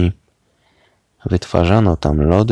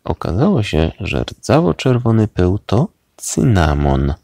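ordinary delivery

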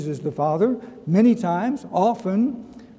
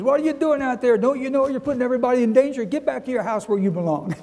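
high, fast mock scolding of a stranger; hammed-up grumpy neighbor shouting from his window; angry neighbor yelling; 7 semitones higher, faster than usual. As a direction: bark at them like the angry neighbor. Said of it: "some guy opened up his window and says".

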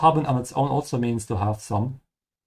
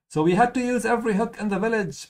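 lightly voiced game girl; 10 semitones higher, faster than usual. Replaying a game character's line.

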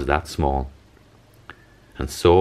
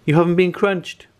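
high, louder joyful cheer; storybook cheer, pitched way up; 13 semitones higher, 4 dB louder than usual. Acted. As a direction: cheer with relief. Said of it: "cheered Mister Hope".

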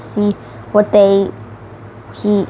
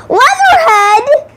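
much higher, louder, slow squeal of the name; character voice; 4 dB louder, slower than usual. A narrator's reading. Narrator lists Superfly's crew, squealed cartoon name.

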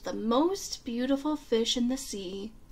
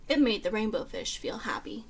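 ordinary delivery

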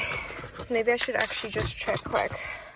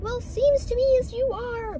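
mock yelling woman; 14 semitones higher than usual. Mimicking the lady who yelled over a stop sign, mock scolding. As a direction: mimic a shrieky telling-off in a high voice. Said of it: "yelling at me".

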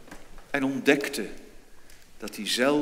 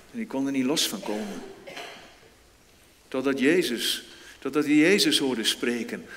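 ordinary talk, nothing marked

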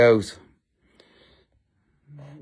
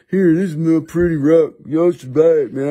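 hammed-up character sales pitch; put-on seller voice; 2 semitones higher, 4 dB louder than usual. Seller begging buyers to take his rock, hammed-up voice. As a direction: push the rock in a silly put-on voice. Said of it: "we talk like this".

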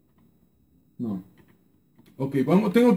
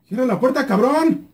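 yelled order; 7 semitones higher than usual. Real, not acted. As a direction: shout it across the room. Yelling at a teammate. (grito al compañero para que cierre la puerta)

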